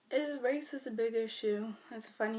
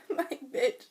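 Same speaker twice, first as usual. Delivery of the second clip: ordinary talk, nothing marked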